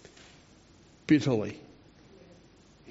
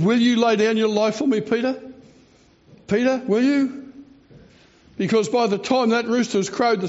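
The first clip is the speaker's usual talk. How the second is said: higher, urgent pleading questions; pleading Jesus; 7 semitones higher than usual. Retold or imitated. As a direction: press Peter hard with rising pleas. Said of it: "and he said".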